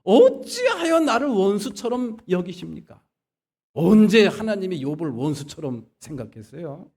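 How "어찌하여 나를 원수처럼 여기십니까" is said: high, loud acted protest; indignant, anguished challenge in prayer; angry complaint to God. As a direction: hurl the question up at God as an accusation. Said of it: "승질을 내면서 저주를 퍼붓더니".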